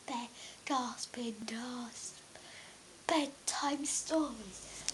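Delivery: breathy whispered show name, slow; whispered title. Acted. Child host whispering the show name to viewers. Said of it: "whispered show title".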